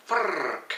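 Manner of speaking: frrrk with a long rolled R, high; frrrk, R rolled like a Scot. Shown as a demonstration. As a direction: trill the R in frrrk. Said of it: "see the word introduced".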